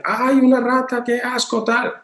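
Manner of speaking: hammed-up yelp of horror at a rat; disgusted shriek. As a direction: shriek like a parent who just spotted a rat. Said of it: "empieza a gritar y dice".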